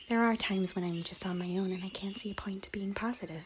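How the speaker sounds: whispered, unvoiced confession; hushed admission of loneliness; confiding whisper. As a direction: breathe it out like a secret. Confessing a private sadness.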